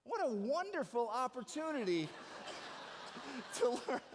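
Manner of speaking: high, teasing line broken by a laugh; teasing through laughter. Retold or imitated. Son teasing his frantic mom, laughing mid-line. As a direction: throw her words back with a giggle. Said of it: "And I said".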